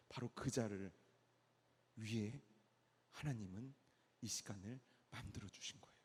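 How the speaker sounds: low, soft, with pauses between phrases; hushed preaching. Real, not acted. Preacher closing the point to the congregation, hushed. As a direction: drop to a hush and let each phrase settle. Sudden hush after the shouting.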